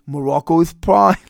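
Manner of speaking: lightly cartoonish, slurred manager impression; Mister Fuji. Podcaster redoing the manager's promise, mock Elmer Fudd voice. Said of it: "my Elmer Fudd, Mister Fuji".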